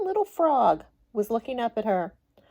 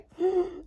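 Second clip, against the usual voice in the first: sharp gasp; 9 semitones higher than usual. Acted. Princess loses ball, starts crying.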